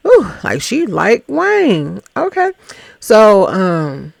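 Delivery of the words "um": normal talking voice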